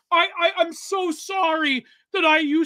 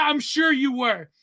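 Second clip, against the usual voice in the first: ordinary delivery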